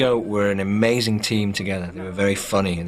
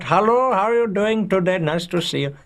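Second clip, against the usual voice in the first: high-pitched, hammed-up Indian accent; strong Indian accent; 11 semitones higher than usual. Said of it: "strong Indian accent".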